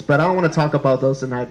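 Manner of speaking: normal talking voice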